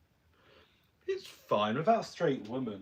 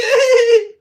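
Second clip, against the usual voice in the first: shrill fan squeal laugh; 26 dB louder than usual. Retold or imitated. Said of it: "things you should never say to a drag performer".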